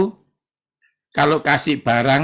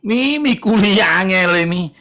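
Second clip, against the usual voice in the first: high whine to his mother; whining student; 5 semitones higher than usual. Retold or imitated. Son whining to his mom about hard college, high whine. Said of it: "Terus kuliah ya ngomong".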